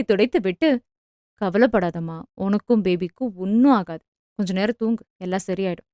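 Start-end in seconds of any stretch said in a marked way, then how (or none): 1.4-5.9 soft, low and gentle reassurance; a husband's hushed, caring murmur; tender comfort. Acted. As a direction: soothe your worried wife softly. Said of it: "என்று பரிவுடன்".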